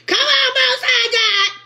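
high, slow, crying plea with a laugh; fervent plea. Real, not acted. Preacher crying out to the Most High, slow and fervent. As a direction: beg the Most High with a crack in the voice.

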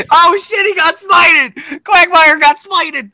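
high, loud, drawn-out cackling cry; helpless high-pitched hilarity; laughing shout. Real, not acted. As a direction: shout it slowly through real laughter. Laughing as player gets smited.

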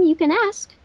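ordinary talk, nothing marked

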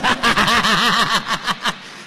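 long, pulsing belly laugh; loud staccato laugh. Real, not acted. Preacher laughs at his own joke about being natural, loud cackling. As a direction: laugh out loud in rapid bursts.

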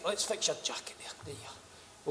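soft fussing whisper; gentle hushed tidying up. Acted.

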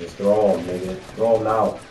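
loud urgent command; shouting orders over battle. Real, not acted. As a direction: yell at him to act now. Urging teammate in fight.

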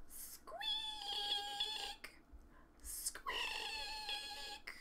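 very high, soft drawn-out squeal; pump squeak. Acted. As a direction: imitate a squeaky pump handle.

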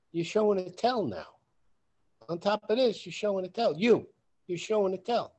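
loud, pressing, repeated call-out; confronting a lying friend, insistent; emphatic accusation. Retold or imitated. Confronting Joe about lying.

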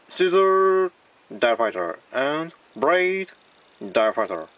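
slow, drawn-out English product names; mock English accent. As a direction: announce toys like an English ad. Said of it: "英語っぽく言ってみます".

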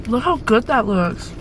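ordinary talk

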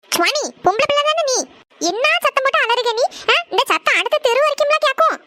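exasperated mother telling off her noisy daughter; scolding. Acted. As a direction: tell her off sharply from across the room.

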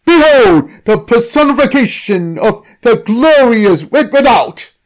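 high, loud triumphant announcement; grand proclamation. Acted. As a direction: herald it to the crowd. Priest proclaims the god.

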